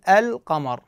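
القمر with the lam clearly sounded, slow. Shown as a demonstration. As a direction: say القمر slowly, sounding al- fully. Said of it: "فاللام القمري معناته أنه اللام مظهرة". (القمر باللام مظهرة بوضوح وببطء)

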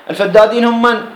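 ordinary delivery

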